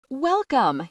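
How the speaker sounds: a host's ringing welcome to the crowd. Acted. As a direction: call the word out to fill the room. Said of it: "his voice ringing out around the space".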